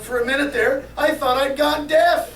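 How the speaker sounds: high, loud punchline relief; hammed-up tipsy relief, slowing at the end; relieved drunk. A narrator's reading. Drunk explaining his relief to the cop, loudly relieved. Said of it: "sighed the drunk".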